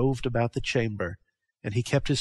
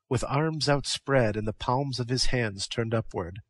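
usual speaking voice

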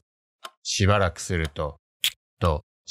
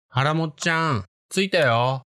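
high, coaxing voice outside the door; eerie stranger's voice; 9 semitones higher than usual. Retold or imitated. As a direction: call sweetly through the door, unsettling. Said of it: "と声が聞こえました".

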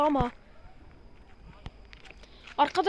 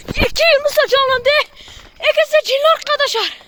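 frightened shouting; 9 semitones higher, 7 dB louder than usual. Real, not acted. Both "possessed", panic.

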